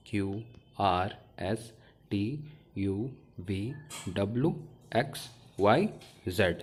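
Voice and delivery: reciting A to Z as letter names, one by one; alphabet letter names. Shown as a demonstration. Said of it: "इनको हमने जिस तरीके से पढ़ा है वो ये तरीका है".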